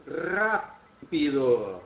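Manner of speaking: "rápido" stretched slowly, first syllable RÁ stressed; teacher drawing out "rápido" for the class, slow and clear. Shown as a demonstration. Teacher spelling out "rápido" to students, slow and stressed. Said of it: "palavras como".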